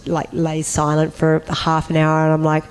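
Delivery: normal talking voice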